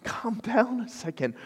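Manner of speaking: softly imitating a composed wife; hushed, measured mock scolding, dropping very soft; calm, cool-headed wife. Wife telling her panicking husband to settle down, calm and hushed. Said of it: "my wife is just really calm and cool-headed".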